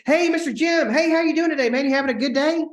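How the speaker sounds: big beaming hello to a stranger on the phone; best friend greeting. Shown as a demonstration. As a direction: greet him like an old friend you're thrilled to hear from. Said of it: "call the person by name".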